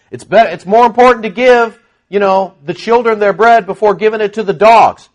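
Jesus testing her. Retold or imitated. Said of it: "But Jesus is going".

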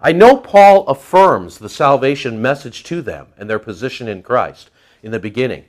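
usual speaking voice

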